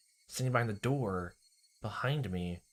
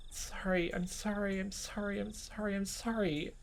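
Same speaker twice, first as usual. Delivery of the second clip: high, softer repeated apology; fading Rena; 11 semitones higher, 5 dB quieter than usual. Acted. Rena repeating apologies, high and soft. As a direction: repeat it like a broken record, fading. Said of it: "Like a broken record".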